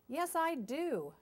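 high, loud, drawn-out retort; crying out. A narrator's reading. Goose answers Duck's scolding, crying out. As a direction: cry it out indignantly, stretching the words. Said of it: "Goose cried out".

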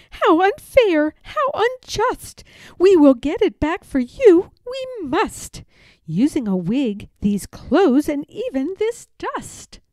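put-on high, outraged child's voice; a little girl vowing justice; indignant Madeline. A narrator's reading.

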